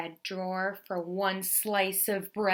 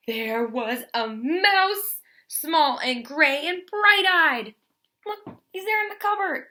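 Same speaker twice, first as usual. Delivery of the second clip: dramatic reveal; 8 semitones higher, 8 dB louder than usual. A narrator's reading. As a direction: draw it out like a big surprise.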